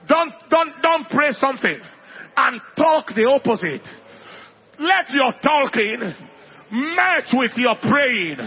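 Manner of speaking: loud, emphatic sermon delivery; preacher exhorting the church; preaching at full force. Acted. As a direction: drive each line home to the congregation.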